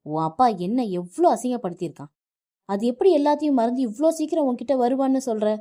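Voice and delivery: husband yelling at his wife about her father; furious shouting. Acted. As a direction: explode at her and rush the words. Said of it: "ஆக்ரோஷமாக அவளை பார்த்து கத்தினான்".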